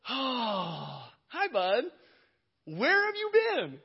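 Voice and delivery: sweet on top, panic underneath; forced calm. Retold or imitated. Strained fake calm to son.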